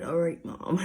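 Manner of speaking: usual speaking voice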